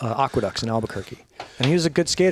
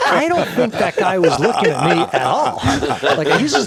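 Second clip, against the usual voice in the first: laughing; 6 semitones higher, 6 dB louder than usual. Real, not acted. Laughing at the gym scene.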